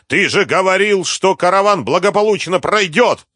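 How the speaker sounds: high, loud, outraged reproach; furious yell. A narrator's reading. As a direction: yell the accusation at full pitch. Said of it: "завопил разъярённый Мурат". (яростный вопль)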